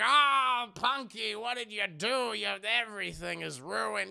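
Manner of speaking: loud, high groaning "Oh" easing off; dismayed scolding. Acted. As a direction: wail at the child in mock despair. Closet spills, old man dismayed.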